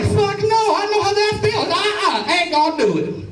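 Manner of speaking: high-pitched, loud comic protest; emphatic refusal. Retold or imitated. Guest refuses his friend's order to castrate a goat, emphatic protest. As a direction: refuse outright, voice shooting way up. Acted refusal to cut goat's nuts.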